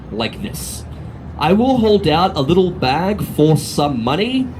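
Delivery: ordinary delivery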